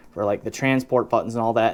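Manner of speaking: ordinary delivery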